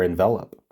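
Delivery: envelop said en-VEL-up, stress on the middle; the verb envelop. Shown as a demonstration. Puzzle player adding the verb reading, stress on VEL. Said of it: "but then there's envelop as well".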